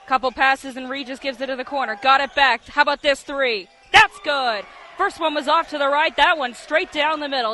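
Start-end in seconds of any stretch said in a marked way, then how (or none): 2.0-7.5 loud play-by-play burst; excited three-point call. Real, not acted. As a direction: build up and exclaim as it drops. Calling a three-pointer going in.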